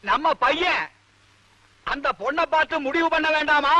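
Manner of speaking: shouting. Acted. Husband repeating himself to his hard-of-hearing wife, shouting high. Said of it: "ஏன் கத்துறீங்க".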